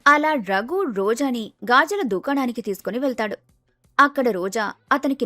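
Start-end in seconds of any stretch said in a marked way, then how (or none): none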